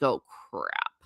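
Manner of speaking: low, soft mock dismay under the breath; a sinking little groan of realisation; dismayed oh crap. Realising the school uses the local dialect, low mock dismay. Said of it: "And I'm like".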